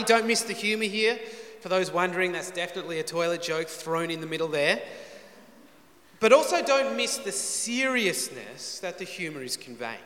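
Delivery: ordinary talk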